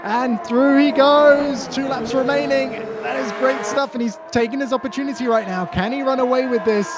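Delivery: rising, building race call; excited commentary. Real, not acted. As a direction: call the overtake with growing excitement. Live play-by-play building excitement.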